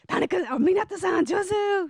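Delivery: high-pitched, quick cheer; excited cheering. Retold or imitated. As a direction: call out praise over the party. Cheering the karaoke singer on.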